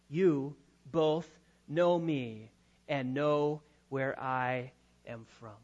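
low, slow, hushed irony; sarcasm. Acted. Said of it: "This is sarcasm".

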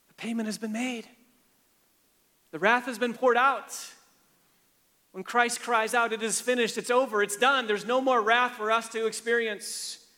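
high, loud crescendo of a revival preacher; preaching at full force. Acted. Preacher proclaims to the congregation that wrath is paid, in full-force preaching. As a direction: lift the whole room, proclaiming it done. Climax of sermon on Christ's sacrifice.

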